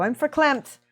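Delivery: overwhelmed, voice jumping high and loud; verklempt. Real, not acted. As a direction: exclaim it as if choked up with gratitude. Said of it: "I'm choked up".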